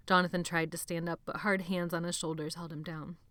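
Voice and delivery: plain reading voice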